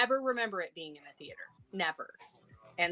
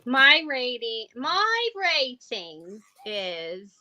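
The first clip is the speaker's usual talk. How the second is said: drawn out; 3 semitones higher, 4 dB louder, much slower than usual. Pretend. Dramatic build-up to a rating.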